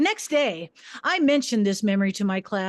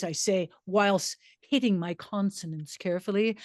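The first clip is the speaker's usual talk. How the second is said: ordinary delivery, nothing marked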